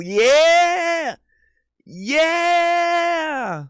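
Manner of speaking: very high, loud hammed-up Italian cheer; jokey Italian excitement; mock Italian hype. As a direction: celebrate like a cartoon Italian. Hyped he's called Italian.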